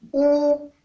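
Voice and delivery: ou on a level tone below the high one; mid tone. Shown as a demonstration. Teacher showing the tones, ou on mid tone. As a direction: hold ou evenly, moderately high. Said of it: "ton moyen".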